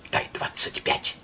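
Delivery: unvoiced urgent whisper. A narrator's reading. Myshlaevsky whispers to Karas, urgent whisper. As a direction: whisper it aside. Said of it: "шепнул Карасю".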